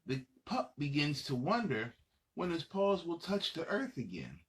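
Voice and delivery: plain reading voice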